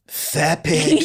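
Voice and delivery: mock Donald retort; Trump impression. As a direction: sneer it like a playground insult. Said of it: "And then Donald would respond".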